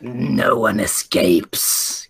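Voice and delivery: low, very slow sinister voice; creepy voice. Retold or imitated. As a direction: menace them slowly. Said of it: "I was doing a really creepy voice".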